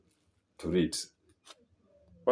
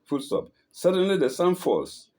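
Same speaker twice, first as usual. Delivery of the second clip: plain reading voice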